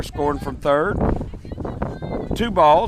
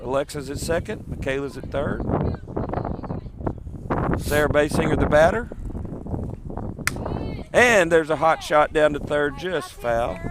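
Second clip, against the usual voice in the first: live softball play-by-play. Acted. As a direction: call the game pitch by pitch. Calls the game live.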